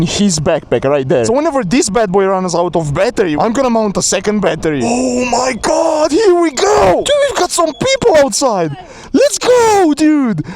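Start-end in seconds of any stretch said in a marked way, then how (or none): 4.8-7.0 excited shout. Real, not acted. Excited riders setting off. 7.0-10.4 high excited yelling; hyped shout. Real, not acted. Hyped riding with friends.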